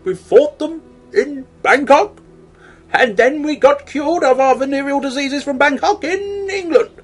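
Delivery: high, theatrical Churchill-style oration; hammed-up heroic proclamation; mock grand speech. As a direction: ham up a rousing wartime speech.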